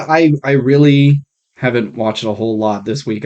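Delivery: ordinary talk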